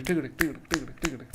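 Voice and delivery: quick tick-a-tick gallop; fast, driving hoofbeat chant; gallop rhythm. Shown as a demonstration. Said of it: "So a gallop would be".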